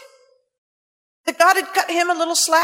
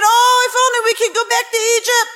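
higher, whiny mock complaint; mock whine; 8 semitones higher than usual. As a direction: whimper it like a spoiled complainer. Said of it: "here they were saying".

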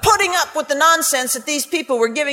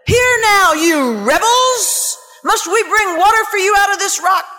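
higher, louder, stretched-out angry shout; furious rebuke; 5 semitones higher, 4 dB louder than usual. Acted. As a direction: thunder at the rebels. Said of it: "He was furious".

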